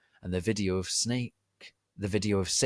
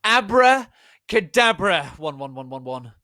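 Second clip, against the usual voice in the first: shouted spell; 9 semitones higher, 12 dB louder than usual. Acted. Ebony casting her final spell, loud grand shout. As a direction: shout the magic words grandly. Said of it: "I shouted".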